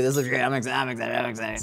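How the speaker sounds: soft, low murmured excitement; quiet excitement. Real, not acted. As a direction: whisper your excitement to yourself.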